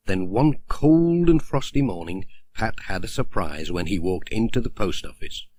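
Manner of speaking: ordinary delivery, nothing marked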